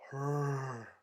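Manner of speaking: low, very soft, ominous single word; hushed acted reveal. Retelling her turning round to name who did it, hushed reveal. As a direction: drop it down low and quiet for the reveal.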